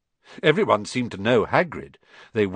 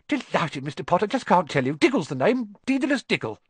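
high, chirpy little man; Daedalus Diggle; 7 semitones higher than usual. Acted. Diggle introducing himself to Harry, high and chirpy. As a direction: pipe up eagerly.

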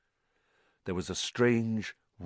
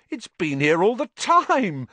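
higher and louder, overjoyed; delighted; 12 semitones higher, 5 dB louder than usual. A narrator's reading. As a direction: say it beaming with delight. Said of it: "said Ron delightedly".

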